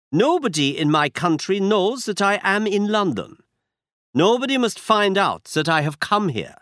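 put-on a masked aristocrat in a German accent. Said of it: "He had a strong German accent".

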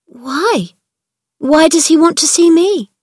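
alarmed. Acted. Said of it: "Mary turned pale".

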